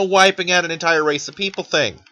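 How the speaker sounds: ordinary delivery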